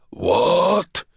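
high rising bark of disbelief; shouted. A narrator's reading. Wounded general shouting at the guards, coughing. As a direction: demand it, choking. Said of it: "the general shouted between coughs and splutters".

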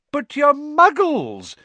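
high, loud, slow delighted cry. A narrator's reading. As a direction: savour the discovery with joy. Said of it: "said Mister Weasley delightedly".

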